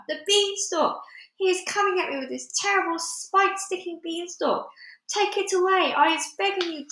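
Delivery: high, frantic begging; screamed plea. A narrator's reading. Giant begging Jack to spare him, screaming. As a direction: plead desperately, voice held high. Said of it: "screamed the flesh lump eater".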